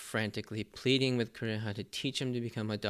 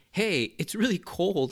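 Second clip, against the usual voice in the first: higher, louder, slow exclamation; mock exclaiming; 8 semitones higher, 5 dB louder than usual. Luffy suddenly noticing the cold, slow loud exclamation. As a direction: blurt it like a sudden discovery. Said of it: "now exclaiming".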